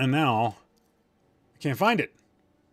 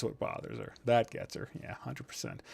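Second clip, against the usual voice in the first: a whispered aside; near-silent whisper; 9 dB quieter than usual. Real, not acted. Host agreeing under his breath with chat, whispered. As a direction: breathe the words with no voice.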